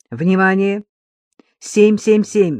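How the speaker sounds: slow, loud alarm call over headset; urgent radio alert, slow and loud; radio dispatcher voice. Acted. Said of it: "раздался в наушниках голос диспетчера".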